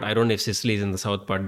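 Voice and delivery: normal talking voice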